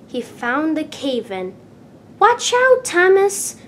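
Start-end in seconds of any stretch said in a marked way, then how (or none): 2.2-3.5 much higher, louder warning cry; Percy warning Thomas in the tunnel, shouting. A narrator's reading. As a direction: yell a warning across the tunnel. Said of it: "Percy puffed".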